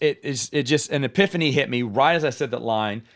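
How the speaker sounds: ordinary talk, nothing marked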